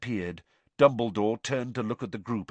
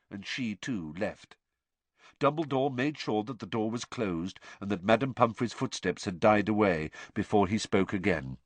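ordinary delivery

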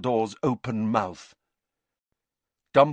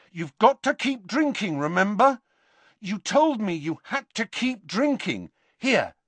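urgent pleading; 10 semitones higher than usual. A narrator's reading. Harry urging Dumbledore on.

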